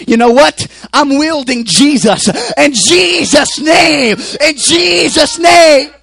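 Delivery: building to a high, drawn-out shout; full-force preaching. Acted. Preacher wielding Jesus' name as his weapon, full-force preaching. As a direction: cry it out loud, stretching the words.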